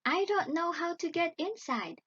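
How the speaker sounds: put-on high, innocent girl's voice; puzzled Gretel. A narrator's reading. Gretel tricks the witch, high feigned puzzlement. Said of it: "pretended to look puzzled".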